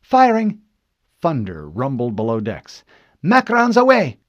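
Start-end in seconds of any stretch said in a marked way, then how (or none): 0.0-0.6 high, loud shout; urgent, raised battle call; lieutenant's firing call. Acted. 3.2-4.2 high, shouted report; loud, excited officer's shout; lieutenant's launch call. Acted. Lieutenant calls out shots fired.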